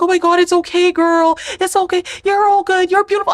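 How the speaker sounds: high gushing comforting voice; lightly voiced cooing reassurance; mock reassuring friend. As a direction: gush like an overeager friend. Imitating people reassuring her.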